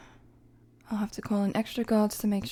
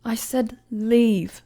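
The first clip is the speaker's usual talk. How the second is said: louder, very slow, ice-cold order; stern command; 6 dB louder, much slower than usual. Acted. Queen dismissing a presumptuous commoner, stern. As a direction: drop each word like a verdict.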